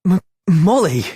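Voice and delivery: high startled exclamation; surprised. Acted. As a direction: blurt her name in shock.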